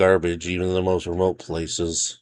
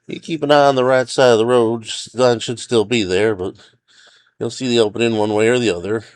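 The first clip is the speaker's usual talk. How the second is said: higher, louder ranger voice giving directions; resigned but helpful, pitched up; park ranger character; 4 semitones higher, 3 dB louder than usual. A narrator's reading. Ranger shows hiker the route on a map, helpful.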